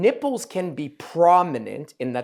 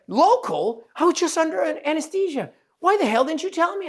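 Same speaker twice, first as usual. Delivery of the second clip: much higher, indignant mock-patient; outraged; 14 semitones higher than usual. Surgeon imitating patient angry she wasn't told, high indignant. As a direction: imitate a patient who feels misled.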